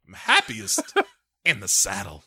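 put-on low, breathy old-Bond murmur with a laugh; Bond's line imitated. Podcast host imitates Bond's saddle line, low and amused. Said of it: "his delivery of this line".

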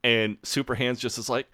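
ordinary talk, nothing marked

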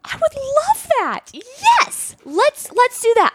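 way up high, gushing and thrilled; hammed-up giddy yes; gushing delight. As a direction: squeal it like the best news ever. Mock delighted gasp retelling.

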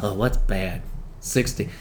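low, soft musing writer; murmured brainstorming to himself; George Lucas. Retold or imitated. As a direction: think aloud quietly, slowly working it out. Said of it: "George in his writing was like".

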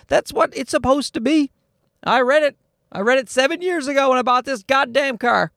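high-pitched, indignant rant; exasperated protest. Real, not acted. Car owner protesting that he was promised room, exasperated. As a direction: insist with the pitch held up high. Ranting about broken promise.

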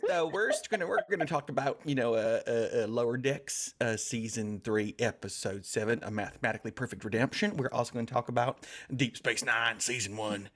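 put-on Southern host voice; mock Southern accent. Host announcing the episodes as young folks from the South, mock Southern accent. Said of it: "I can't sustain that for much longer".

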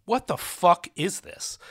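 drawn-out, incredulous quoted question; baffled reaction. Acted. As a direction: voice a bewildered viewer's disbelief. Imagined viewer reacting to film.

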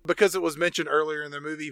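ordinary delivery

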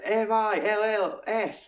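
slow, high, letter-by-letter sneer; mocking killer spelling out the cop's name, slow and high; Kevin Spacey spelling it out. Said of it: "he's doing an accent".

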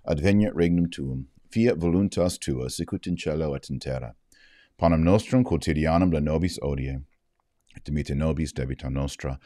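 steady, subdued recited prayer; Latin prayer. Acted. Vlogger leads viewers in the Our Father in Latin, subdued and steady. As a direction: pray it reverently, evenly.

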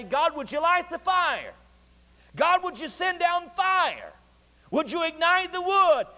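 high, drawn-out appeal; imitated pleading call. Prophets begging their god for fire, imitated pleading. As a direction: call slowly up to heaven, pitched high. Prophets' vain plea, acted out.

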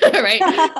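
high, loud burst of laughter on right; laughing. Real, not acted. Coach laughing at the high performer's boast, loud and high. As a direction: let the laugh take over the word. Laughs after "I wanna be the best.

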